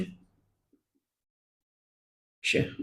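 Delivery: Akshay whispered slowly, stretched out. Shown as a demonstration. Teacher whispering Akshay slowly for students. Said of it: "इसका उच्चारण तो ये होगा".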